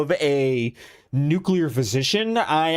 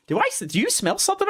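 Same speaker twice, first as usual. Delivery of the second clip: anxious question; 13 semitones higher, faster than usual. Retold or imitated. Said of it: "I'm asking my girlfriend".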